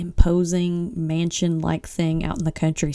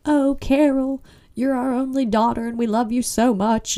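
fake sweet foster parents; 5 semitones higher than usual. Mocking the greedy foster parents fawning over Carol, sickly sweet. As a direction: pour on the false affection. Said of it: "then the Jimmets were like".

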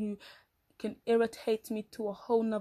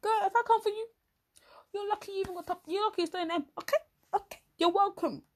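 high, half-whispered sass; sassy scolding; 10 semitones higher than usual. Acted. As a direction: scold her with high attitude. Mock threat to complaining friend.

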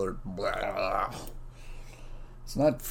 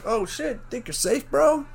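much higher, mock challenge; mock taunt; slower than usual. Yelling back at the driver, mock taunting. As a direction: hurl it back mockingly at the driver.

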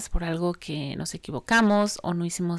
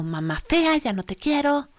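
mildly imitated child's tantrum, rising higher; retold angry child; 7 semitones higher than usual. Upset son lashes out at mom, mild mock anger. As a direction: imitate a cross little boy, lightly. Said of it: "se enojó tanto".

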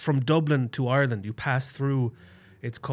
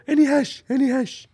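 high loud pleading voice, much higher and louder; mock the deaf guy begging for hash, pitched up; imitated deaf traveller; 12 semitones higher, 6 dB louder than usual. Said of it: "this guy would come up to me and go".

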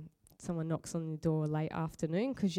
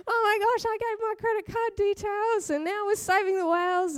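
high-pitched sobbing wail; high, tearful retold wail; crying; 13 semitones higher, 3 dB louder than usual. A wife wailing to her husband about her mistake, crying high and loud. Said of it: "burst into tears".

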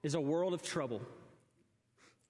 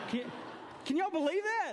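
incredulous; 10 semitones higher than usual. Real, not acted. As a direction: ask them in comic disbelief. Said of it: "exasperated aside to congregation".

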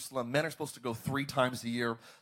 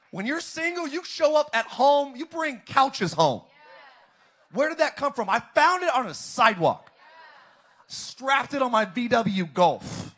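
comic excitement; 8 semitones higher, 7 dB louder than usual. Real, not acted. Comic bachelor bit.

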